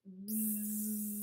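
buzzing like a bumblebee; low, soft buzzing bee sound. Pretend.

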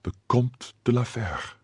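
a soft murmured gasp of recognition. Acted. Milady recognizes her husband, murmuring in shock. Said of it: "murmured Milady".

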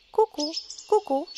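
a light sung cuckoo call. A narrator's reading. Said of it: "Zakukała".

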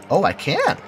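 ordinary talk, nothing marked

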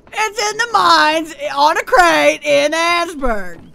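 patient over-explaining. Real, not acted. Finally giving the full location, slow and deliberate. Summing up directions.

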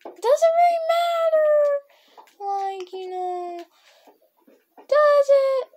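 shrugging off the risk in a silly high voice; silly squeaky voice. Pretend. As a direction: squeak it out like a cartoon.

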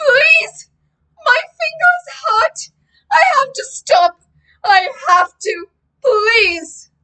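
high, loud wailing plea; desperate pleading shout. A narrator's reading. Boy begs to stop playing guitar, desperate high shouting. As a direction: beg at the top of your voice, high and tearful. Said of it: "I shouted".

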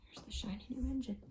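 hushed whisper aside; secretive storyteller whisper. Real, not acted.

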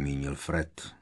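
plain reading voice

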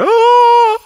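loud, very high shocked "Oh!"; startled gasp. Retold or imitated. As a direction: cry out as the lion roars. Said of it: "And everyone was like".

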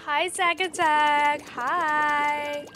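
adoring greeting. Real, not acted. Player greeting beloved Zack Attack, adoring and drawn out. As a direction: stretch it out like cooing at a crush.

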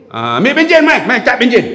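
arahan garang bernada tinggi; marah. Retold or imitated. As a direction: lakonkan pegawai yang tidak sabar. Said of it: "Dia kira nak marah orang kan". (arah orang dengan nada marah)

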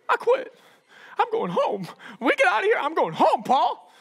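high-pitched mock whining; whiny quitter. Mark quitting on Paul, high whiny complaint. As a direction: whine it like you're giving up. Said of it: "put his head down and be like".

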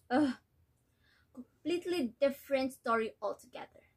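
worn-out mother, resigned and hurt; disappointed mother; weary mother. Acted. Nella recalls obedience, despairs of daughter.

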